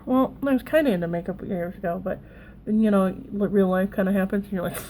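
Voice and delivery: normal talking voice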